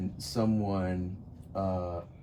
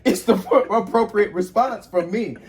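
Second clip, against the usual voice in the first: laughing; 9 semitones higher, 8 dB louder than usual. Real, not acted. Laughing through the line.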